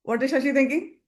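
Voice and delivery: ordinary delivery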